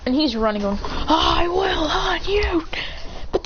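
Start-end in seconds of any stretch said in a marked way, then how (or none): 0.8-2.7 high, slow, whispery rasp of a cry; mock menacing threat. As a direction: stretch the threat out high and breathy. Character running, threatening.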